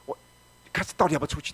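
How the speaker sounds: ordinary talk, nothing marked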